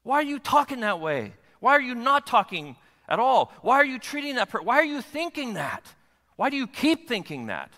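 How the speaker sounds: high, exasperated scolding in questions; righteous anger. Acted. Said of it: "There's this righteous anger when he looks at me and says".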